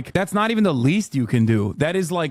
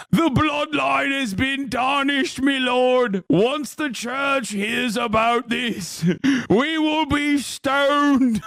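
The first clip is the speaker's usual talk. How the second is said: much higher, slow mock peasant voice; medieval peasant; 9 semitones higher, much slower than usual. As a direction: ham up a fawning medieval servant. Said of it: "like, a medieval peasant take".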